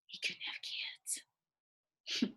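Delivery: whispered confidence; hushed confiding aside. Acted. Widow confiding to her book club, hushed whisper. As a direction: drop to a whisper for a delicate secret. Confides husband's private shortcoming.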